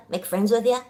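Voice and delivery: ordinary talk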